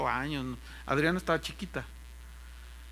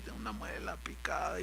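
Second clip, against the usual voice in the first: whispered, lower, pained moan about the tooth; whimpering pastor; 10 semitones lower, 12 dB quieter than usual. Retold or imitated. (gemido susurrado del pastor con dolor de muela)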